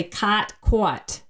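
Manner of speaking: "cot" with open ah, then "quat" with a rounded aw; unmerged vowels. Shown as a demonstration. Linguist showing New York or Tennessee vowels, distinct "cot" and "quat". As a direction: slowly contrast "cot" with a rounded, w-coloured "quat". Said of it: "if you're from another part like New York or Tennessee".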